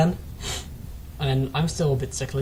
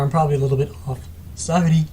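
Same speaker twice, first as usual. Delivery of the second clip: normal talking voice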